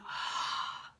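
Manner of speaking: breathy, voiceless exhale; sigh of relief. Shown as a demonstration. Teacher showing how release feels, relieved exhale. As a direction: let it all out in one airy sigh.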